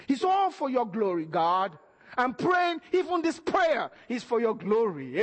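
ordinary talk, nothing marked

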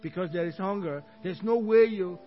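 ordinary talk

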